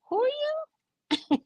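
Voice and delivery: very high, slow and drawn out, like looking down her nose; mock disdain. To the stranger texting her, a slow, very high mock-haughty question. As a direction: stretch it out up high with exaggerated disbelief. Retelling her reply to Caesar.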